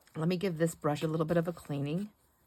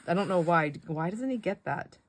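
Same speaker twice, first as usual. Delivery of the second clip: usual speaking voice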